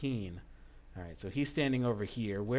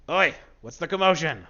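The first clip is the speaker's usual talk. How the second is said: loud rough call from afar, higher; bandit leader shouting; 7 semitones higher, 12 dB louder than usual. Acted. Bandit leader calls out to his men, loud shout. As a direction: bellow it across the camp. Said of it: "the bandit leader says".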